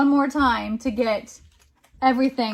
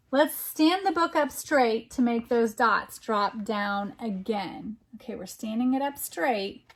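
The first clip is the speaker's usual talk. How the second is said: ordinary delivery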